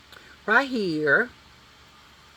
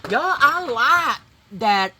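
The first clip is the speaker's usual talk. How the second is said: higher, louder and slow, thrilled; delighted; 6 semitones higher, 10 dB louder, slower than usual. Real, not acted. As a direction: savor the look with real delight. Loves the makeup result.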